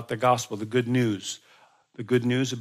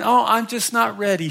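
put-on polite old lady; 8 semitones higher, 4 dB louder than usual. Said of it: "she'd say".

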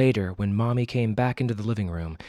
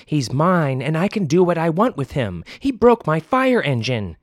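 put-on louder angry child voice; defiant child; 6 semitones higher, 6 dB louder than usual. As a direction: shout back at mom. Child shouting back.

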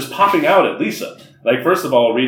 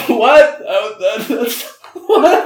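laughing disbelief; 10 semitones higher, 3 dB louder, much slower than usual. Real, not acted. Shocked at friend's behaviour.

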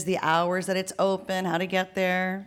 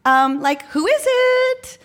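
put-on very high, loud, drawn-out girl's call; 5 dB louder, slower than usual. Girl in another apartment answers door, high girl's voice. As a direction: call out like a girl through an intercom. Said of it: "we hear a girl from a different apartment say".

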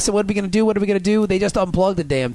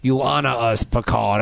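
low, solemn imitated alien; mock alien reply; 7 semitones lower, slower than usual. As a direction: pronounce it gravely, pitched well down. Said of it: "And they said".

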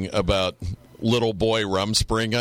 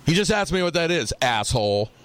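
higher, fast exasperated snap; exasperated mom; 6 semitones higher than usual. Retold or imitated. Mom snapping at dad over the kid's question, higher and fast. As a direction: fire it back at him, fed up. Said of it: "She goes".

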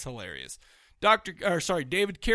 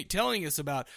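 normal talking voice

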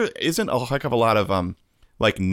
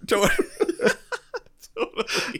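high laughing word; giggling it out; faster than usual. Real, not acted. Laughing after the joke.

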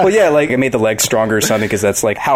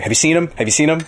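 pushy questioning; 6 semitones higher, much faster than usual. Acted. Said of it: "being very, like, pushy about it".

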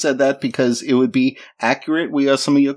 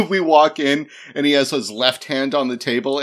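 usual speaking voice

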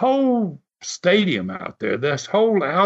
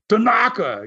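high mock yell of the name; a manager yelling his wrestler's name up high; retold scream; 6 semitones higher than usual. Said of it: "he started screaming".

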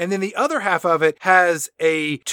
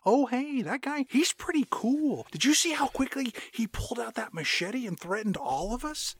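soft, higher, admiring movie-star impression; hushed, guileless fan-boy voice; laid-back crewmate; 5 semitones higher, 8 dB quieter than usual. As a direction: marvel quietly and dreamily at the villain.

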